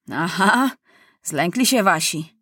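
lightly jeering soldier; a touch of gloating; mocking. A narrator's reading. Enemy soldiers to their prisoners, mild jeer. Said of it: "drwili żołnierze".

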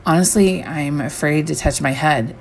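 usual speaking voice

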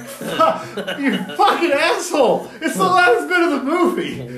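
high, loud outburst through laughter; comic retold fury; exasperated rage. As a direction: yell it slowly at the heckler, laughing. Acted yelling at the heckler.

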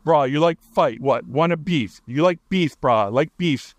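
light Hawaiian pidgin, challenging; Hawaiian pidgin taunt. Retold or imitated. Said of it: "I heard".

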